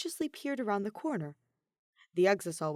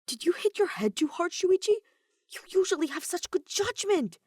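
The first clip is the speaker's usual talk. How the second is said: higher, incredulous worried question; horrified concern; 12 semitones higher, 5 dB louder than usual. Acted. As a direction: fuss over a friend acting strangely. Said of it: "shot him a horrified look".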